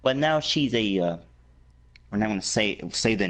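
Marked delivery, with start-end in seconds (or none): none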